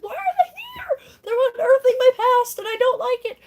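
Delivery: very high, loud panicked wail; mock hysterical outburst; freaking out. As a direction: shriek it in hammed-up panic. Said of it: "she's freaking out".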